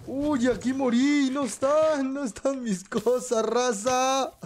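high, slow dismayed wail; sorpresa desesperada. Real, not acted. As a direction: lament the lost items, drawn out. Pierde sus cosas en el juego. (lamento agudo y lento)

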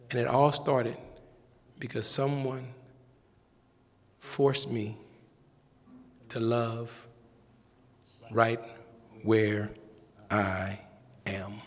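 preacher calling and answering the congregation, slow and low; preaching cadence. Acted. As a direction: draw each word out for the congregation. Sermon climax call and response.

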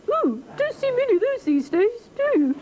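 very high, tiny pursed-mouth voice; alarmed frog. Acted. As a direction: shrink the wide-mouthed frog into a squeaky small mouth. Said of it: "the frog hops a bit further back and he says".

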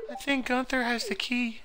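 very high-pitched put-on kid voice; accented kid. Kid telling friend who has the sewer key, very high accented voice. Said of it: "that kid has an accent like that".

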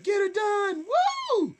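very high, loud catchphrase and whoop; imitated yell. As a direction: holler like a rowdy drunk fan. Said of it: "So she was yelling".

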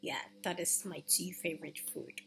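normal talking voice

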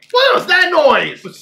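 high, loud and startled; surprised. Real, not acted. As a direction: react to a sudden noise. Startled by a sound.

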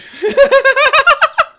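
very loud, high-pitched rattling laugh; cracking-up laugh. Real, not acted. Streamer ruining the intro take, loud high cackle. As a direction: burst out in shrieking, rapid laughter.

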